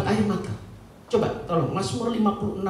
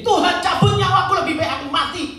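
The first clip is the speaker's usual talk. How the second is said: acted outcry to God, high and loud; angry prophet; 11 semitones higher, 6 dB louder than usual. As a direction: cry out in protest as the angry prophet. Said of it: "dengan mereka marah". (seruan marah nabi kepada Tuhan)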